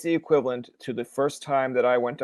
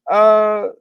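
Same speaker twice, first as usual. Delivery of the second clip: high, sustained radio-update voice; projected student-radio announcement; traffic reporter; 7 semitones higher, 5 dB louder than usual. Retold or imitated. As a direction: imitate reading a traffic warning on air. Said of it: "How would you actually say it".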